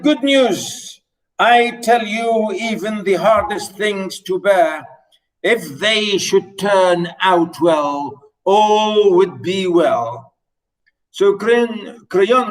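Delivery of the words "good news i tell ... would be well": raised pitch, slow and weighty lines; dramatic reading. Acted. Teacher performing Creon's reply for students, dramatic reading. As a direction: read Creon's good news grandly to the class.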